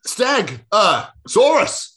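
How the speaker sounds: slow, high, laughing dinosaur announcement; impactful intro. Acted. Tom announces dinosaurs for an impactful start, slow. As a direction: drag out the name for drama. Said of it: "Make it impactful".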